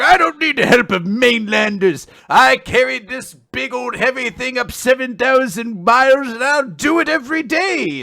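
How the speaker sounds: put-on high, loud, cantankerous old-timer; hammed-up old codger boasting; stubborn old man. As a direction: rant with proud, pig-headed defiance. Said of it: "he's a very stubborn old man".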